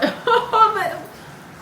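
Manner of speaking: high startled burst of laughter. Real, not acted. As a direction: laugh in real disbelief. Laughs at the surprising message.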